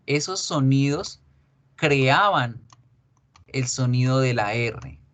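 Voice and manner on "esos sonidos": normal talking voice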